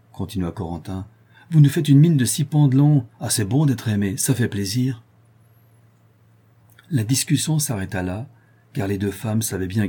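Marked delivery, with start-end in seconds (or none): 1.5-5.0 sarcastic. Acted. Corentin sarcastic.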